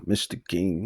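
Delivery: usual speaking voice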